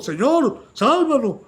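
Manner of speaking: loud, high cry of panic; fearful plea. Acted. Disciples in the storm begging the Lord, panicked shout. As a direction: beg him to wake and save them. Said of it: "pues, llenos de temor".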